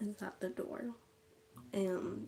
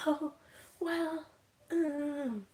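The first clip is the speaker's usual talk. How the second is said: higher, drawn-out mock hesitation; hesitant reply, imitated; 7 semitones higher, 4 dB louder, much slower than usual. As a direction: stall awkwardly on each word, pitched up. Imitating aunt's awkward hesitant reply.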